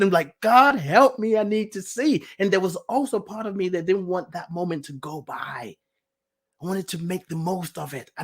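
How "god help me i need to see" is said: high, urgent plea to God; re-enacted desperate appeal; pleading prayer. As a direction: beg for help, voice raised.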